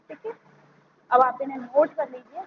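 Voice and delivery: ordinary talk, nothing marked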